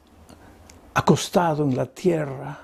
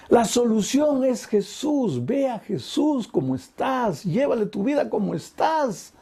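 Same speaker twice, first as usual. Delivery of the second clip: high, slow preaching crescendo; impassioned preaching; 8 semitones higher than usual. Acted. Preacher urges the broken to come to Jesus, impassioned and slow. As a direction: call them forward with every line. Climactic altar call.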